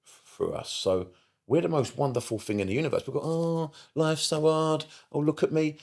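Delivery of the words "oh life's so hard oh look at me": mock whining. Mocking people who moan about life.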